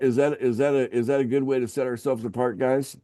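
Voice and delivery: ordinary talk, nothing marked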